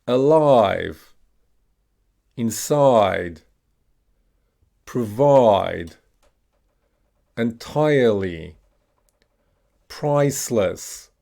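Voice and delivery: each word alone, drawn-out EYE as in "Alive"; "priceless" with a long EYE, falling tune; long I modelled. Shown as a demonstration. Teacher has learners repeat long I words, careful drill delivery. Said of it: "we're gonna pronounce the letter I".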